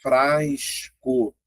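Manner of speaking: frasco drawn out very slowly, both S and C heard; slow demo. Shown as a demonstration. Teacher slowly pronouncing frasco for the students. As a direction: stretch frasco so each sound is clear. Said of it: "se você pronunciar".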